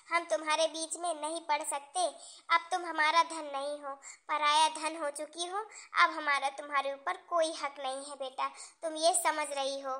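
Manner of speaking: soft, low motherly explanation; gently, firmly decline to step in; subdued mother on the phone; quiet resignation. Acted.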